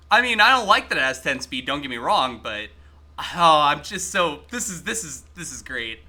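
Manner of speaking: ordinary talk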